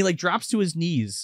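ordinary delivery

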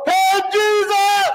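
high, long-drawn calling out; imitated holler. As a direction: holler as if across the water, stretched out. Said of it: "maybe he hollered".

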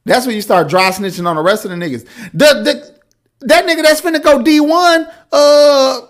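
high, loud, laughing rant; excited rant. Real, not acted. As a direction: get worked up and laugh through it. Hyped, laughing rant about being twenty-one.

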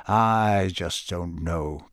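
very slow and low; slow, troubled. A narrator's reading. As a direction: let it sink, slowly. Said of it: "said Sirius slowly".

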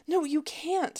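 high-pitched urgent cut-in; interrupting. A narrator's reading. Caller stopping rep from transferring her, interrupting. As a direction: cut the agent off, pitch jumping up. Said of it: "Sloane interrupted".

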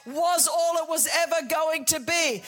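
God announcing a new way, loud; voicing God's promise. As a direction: declare it like a promise. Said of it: "It's like".

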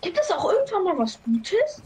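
high-pitched, fed-up complaint; exasperated. Real, not acted. Co-host fed up with the jokes found online, exasperated. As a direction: plead in high-pitched exasperation for something better.

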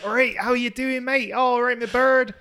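farmery Plymouth accent, high-pitched. Shown as a demonstration. Showing friends how locals greet a mate, broad farmery Plymouth accent. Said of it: "like, farmery".

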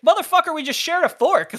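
high, loud indignant outburst; hammed-up angry protest; mock outrage. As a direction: imitate a partner exploding in disbelief. Retells partner's outraged reaction to covid.

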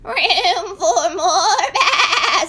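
high, slow laughing bleat; singsong sheep. A narrator's reading. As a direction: sing it like a giggly sheep. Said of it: "sang Woolly Sheep".

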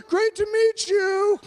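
high, loud, drawn-out holler; yelled farewell. Retold or imitated. Crowd waving him off as he leaves, yelled farewell. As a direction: holler it after someone leaving. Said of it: "were just yelling at me".

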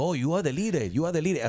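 English with a mocking Puerto Rican accent. Host mimicking [name]'s mockery of the singer, mock Puerto Rican accent. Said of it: "se está burlando del acento".

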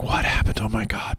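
whispered in dawning horror, breath only; shocked whisper. Retold or imitated. Retelling the driver learning of the crash, whispered. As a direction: hush it like a guilty secret. Man hears about the accident.

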